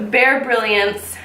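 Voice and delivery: usual speaking voice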